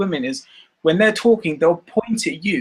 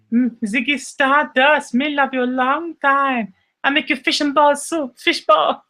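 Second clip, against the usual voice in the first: high, hammed-up Chinese-accented woman; mock Chinese accent; 8 semitones higher than usual. Retelling a Family Guy gag as lovestruck woman, mock Chinese accent. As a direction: put on a cartoon Chinese accent, pitched high. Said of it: "There was a Family Guy thing about".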